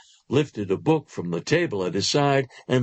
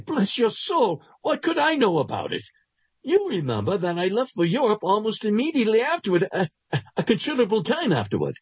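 higher, quick flustered denial; nervous Fleming; 6 semitones higher than usual. Acted. Fleming dodging the doctor's question, flustered and higher. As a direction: brush off the question, too fast and too high. Fleming denies knowledge, rattled.

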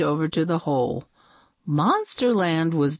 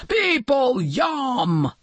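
hungry sea monster; 8 semitones higher, slower than usual. A narrator's reading. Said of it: "roared the sea monster".

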